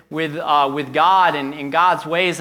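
ordinary delivery

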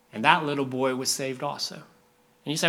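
normal talking voice

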